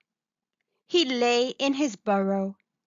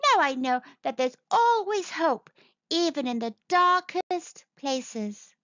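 higher-pitched, contented little creature; happy little mole; 6 semitones higher than usual. A narrator's reading. Little mole shares a lesson with mamma, happily. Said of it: "Little Mole said happily".

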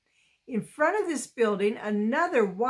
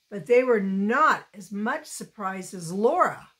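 ordinary delivery, nothing marked

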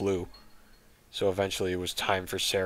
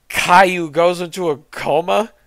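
much higher, louder outraged rise on Caillou; disbelieving; 11 semitones higher, 9 dB louder than usual. Real, not acted. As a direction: shout it in disbelief. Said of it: "recap in disbelief".

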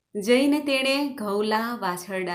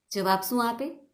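ordinary delivery, nothing marked

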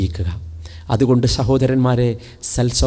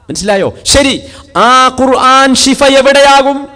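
preaching at full force; 11 semitones higher, 9 dB louder than usual. Acted. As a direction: proclaim the verse to the hall. (ഉച്ചത്തിൽ പ്രസംഗം)

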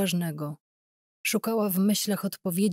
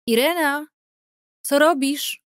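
higher, louder call from another room; mother calling; 9 semitones higher, 6 dB louder than usual. Acted. Sick mother calls Irena from the bedroom, raised high call. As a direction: call out to her daughter across the flat. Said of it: "zawołała matka z sypialni słabym głosem".

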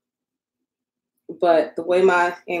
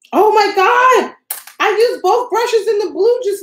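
much higher and louder, slow dismayed cry; real panic; 12 semitones higher, 5 dB louder than usual. Real, not acted. Crafter realizing she used both brushes, high slow panicked cry. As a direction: panic over the mixed-up brushes.

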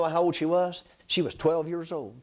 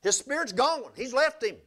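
emphatic preaching; 5 semitones higher than usual. Acted. Preacher stressing dead.

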